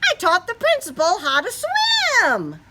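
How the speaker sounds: high, excited proud boast. Acted. Froggy boasting to his father, high excited voice. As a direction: brag it out, high and bright.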